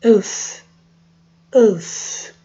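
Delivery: Œuf with lips pushed far forward; teacher showing the lip rounding; rounded lips. Shown as a demonstration. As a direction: pout hard on Œuf. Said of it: "Notaram que é muito bico".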